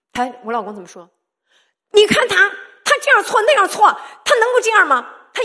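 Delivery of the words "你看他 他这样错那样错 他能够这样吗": higher, louder imitation of an exasperated husband arguing back; mock the husband snapping back in protest, pitched up and loud; husband's angry retort.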